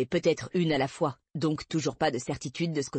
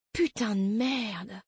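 slow, drawn-out shocked curse; shocked exclamation; 4 semitones higher, much slower than usual. A narrator's reading. Didi, seeing herself in the mirror, slow stunned curse. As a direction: she sees her wrecked face and gasps the oath out slowly. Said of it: "s'exclama-t-elle".

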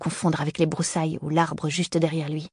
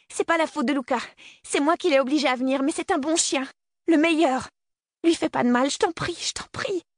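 high, frantic begging for the dog; panicked high pleading, rising to the end; 9 semitones higher than usual. Acted. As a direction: plead for his life, voice pitched high. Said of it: "en suppliant".

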